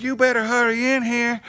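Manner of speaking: high, loud put-on wife voice; wife hollering. Wife summoning her husband indoors, mock urgent holler. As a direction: urge it at a holler, imitating her. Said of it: "my wife hollers out the back door".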